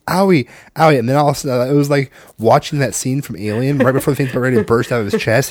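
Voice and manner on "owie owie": put-on higher, louder toddler owie; imitating a hurting small child; child pointing at belly, whimpering; little kid voice. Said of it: "He's like".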